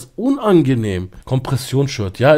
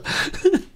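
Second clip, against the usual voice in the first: laugh; 4 dB quieter than usual. Real, not acted. Continues laughing after chat message.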